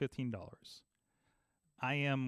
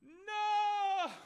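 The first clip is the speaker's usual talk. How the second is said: high, wailing "No!" of despair; a sudden high, ringing no; anguished cry. Retold or imitated. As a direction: cry out as the call drops. Call back hangs up on him.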